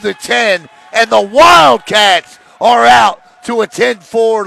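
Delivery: big, driving sportscaster shout; excited play-by-play. Real, not acted. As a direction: punch out the score with rising excitement. Live call of team's lead.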